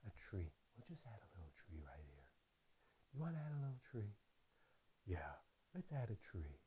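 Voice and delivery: very soft, low, hushed painter voice; soothing TV painter, hushed and low; Bob Ross impression. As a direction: coax the canvas like Bob Ross, barely above a murmur. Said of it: "Imagine me as Bob Ross".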